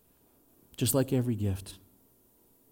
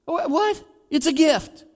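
baffled giver; 13 semitones higher, 4 dB louder, slower than usual. Acted. Giver baffled by repayment offer.

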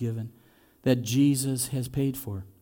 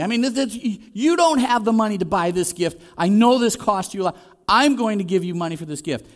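eager to repay; 5 semitones higher, 4 dB louder than usual. Acted. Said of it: "and they go".

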